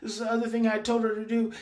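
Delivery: ordinary delivery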